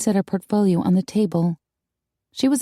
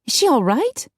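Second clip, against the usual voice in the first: high-pitched worried question; squeaky alarm; 9 semitones higher than usual. A narrator's reading. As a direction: ask in alarm, voice jumping up. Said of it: "Darcy squeaked".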